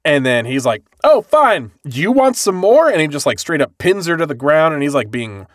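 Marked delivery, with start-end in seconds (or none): 1.0-2.9 loud, high and taunting; aggressive taunt. Retold or imitated. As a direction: sneer it and push in, louder. Aggressive man pins girl down.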